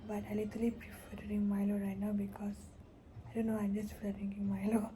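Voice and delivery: soft early-morning whisper. Real, not acted. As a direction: whisper so nobody wakes.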